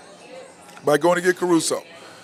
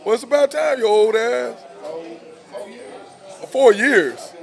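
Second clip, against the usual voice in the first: excited greeting; 7 semitones higher, 3 dB louder than usual. Real, not acted. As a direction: hail a buddy who just walked in, teasing him. Friend walks up during live show.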